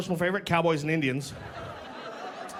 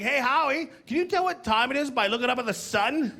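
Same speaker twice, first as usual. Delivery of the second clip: put-on higher-pitched childlike question; imitating curious kids; 7 semitones higher than usual. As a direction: ask like a naive kid, voice lifted. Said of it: "They'd be like".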